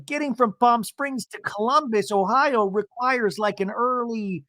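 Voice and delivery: high, slow husband imitation; Seth, explaining. Seth justifying early exit, high slow mimicry. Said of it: "And Seth goes".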